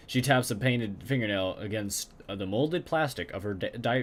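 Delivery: normal talking voice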